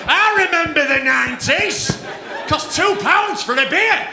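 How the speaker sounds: loud, high mock nostalgic shout; cheering old-timers. Old crowd members clapping for the nineties, drawn-out loud shout. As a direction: holler it slowly like old punters cheering the past. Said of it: "the old fuckers over there clapping".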